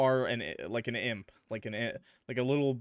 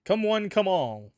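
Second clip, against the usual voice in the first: showman announcer; 7 semitones higher, 5 dB louder, slower than usual. Acted. Announcer-style welcome.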